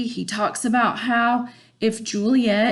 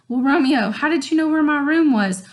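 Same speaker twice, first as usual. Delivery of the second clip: put-on higher, curious girl voice; Juliet; 6 semitones higher, 4 dB louder than usual. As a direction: quiz him up high like a wary Juliet.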